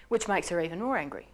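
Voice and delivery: usual speaking voice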